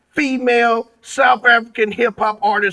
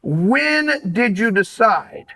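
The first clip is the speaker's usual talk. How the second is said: usual speaking voice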